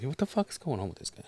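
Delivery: soft, slightly lifted incredulous question; mock-hushed disbelief; hushed bewildered aside. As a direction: ask a friend under your breath, baffled. Bewildered aside to friend mid-show.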